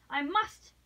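urgent and emphatic, a touch savage; almost savage energy. A narrator's reading. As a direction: insist with fierce resolve. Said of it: "she repeated with almost savage en-, energy".

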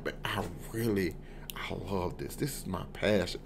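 mock whisper of her confession; whispered aside. Husband imitating wife's whispered confession to him. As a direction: breathe it as her secret. Quoting Viv inside imagined rant.

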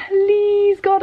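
high pleading please God; desperate plea. Retold or imitated. Retelling her lesson, a high hopeful plea. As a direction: beg silently for it to work. Praying mid-lesson in retold thought.

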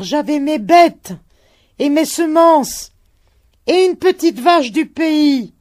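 high, loud shouting. A narrator's reading. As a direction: shout the memories over the wind. Shouted dialogue continues.

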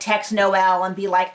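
ordinary talk, nothing marked

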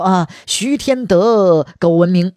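very slow, stretched-out names; drawn-out answer. Acted. He Shen answering the retired emperor's riddle, slowly intoning names. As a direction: intone each syllable long and evenly.

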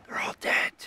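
the repeat breathed out, no voice; broken-off echo. Acted. Artisan repeating the cry, spent and breathy. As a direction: let the second one collapse into breath. Repeated line drops away.

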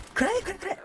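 high, frantic repeated yells; panic-fire, shouting each one. Real, not acted. Firing, pitch very high.

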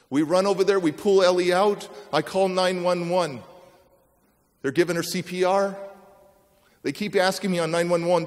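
normal talking voice

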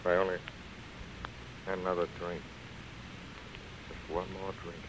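sinking into the drink, barely audible; a weary drunk's soft murmur to himself; drunken murmur. Acted. Drunk man alone in hotel room.

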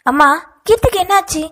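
high, loud, panicked question to his mother; a son rushing in, frightened for his sister; alarmed high cry; anxious. A narrator's reading. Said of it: "பதற்றத்துடன் இருவரும் கேட்டனர்".

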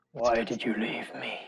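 low, slow and soft figure in a dream. Acted. Game master voices tall dream figure to dreamer, low and slow. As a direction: speak it slowly, low and hushed. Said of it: "the Minotaur and says".

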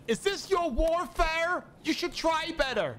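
high, slow taunting yell; mocking enemy shout. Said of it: "That guy just shouted".

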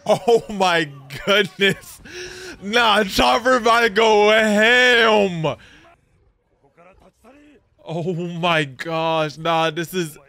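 high, loud, slow cries of shock; overwhelmed shouting. Real, not acted. Fan overwhelmed by a sad scene, crying out loud and slow. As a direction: wail at the screen as it overwhelms you. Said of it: "My heart can't take this".

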